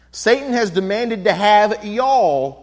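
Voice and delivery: "y'all" drawn out, slow and loud; slow, emphatic Texan "y'all"; Texas y'all. Shown as a demonstration. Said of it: "you would say in Texas".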